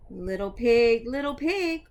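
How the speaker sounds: loud sing-song call at the door; a wolf calling out to the little pig, loud and sing-song. Acted. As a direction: call out to the pig through the doorway. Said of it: "so I called".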